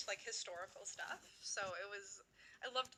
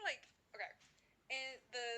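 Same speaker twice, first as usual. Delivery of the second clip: ordinary talk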